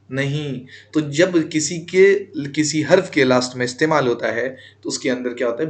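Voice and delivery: ordinary talk, nothing marked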